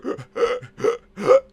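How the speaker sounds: high wheezing gasp for air; mock winded high gasp. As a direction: gasp as if the wind was knocked out. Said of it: "So he's just going".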